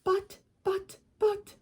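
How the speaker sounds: high stuttering wail; stammering cry. Acted. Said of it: "cried Missus Gregg".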